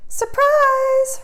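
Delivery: the mice unveiling the dress; joyful cry. A narrator's reading. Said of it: "her friends cried".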